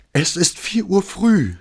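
high, slow, almost hysterical lament; wailing, near tears. A narrator's reading. Sleepless neighbour pleading with the officer, near tears. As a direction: wail it on the edge of crying. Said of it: "jammerte sie, dem Weinen nahe und fast hysterisch".

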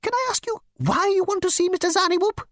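high, fussy insect voice; insect receptionist. Acted. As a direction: squeak it like a prissy bug.

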